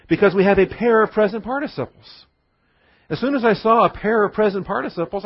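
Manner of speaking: thrilled by the grammar, lifting the voice; excited. Real, not acted. Preacher to congregation, excited about present participles. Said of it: "I got excited".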